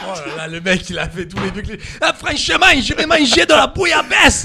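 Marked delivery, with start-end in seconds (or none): 2.0-4.4 loud, high caricature of a Marseillais; mock Marseille accent. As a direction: ham up a Marseille accent at full volume. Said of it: "avec l'accent marseillais". (caricature d'accent marseillais)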